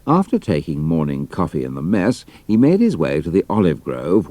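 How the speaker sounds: plain reading voice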